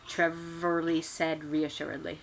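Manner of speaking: ordinary delivery, nothing marked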